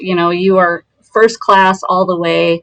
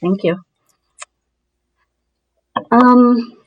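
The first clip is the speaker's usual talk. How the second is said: ordinary talk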